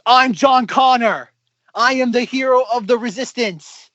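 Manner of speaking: hammed-up heroic proclamation, pitched high; mock Jason Clarke. Podcaster mocking a weak resistance-leader performance, hammed-up proclamation. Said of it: "Jason Clarke's just like".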